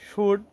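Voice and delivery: "শুড" with oo and no l; correct pronunciation. Shown as a demonstration. Teacher repeats the right form for learners, "শুড" pronounced shood. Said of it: "এটির উচ্চারণ হবে".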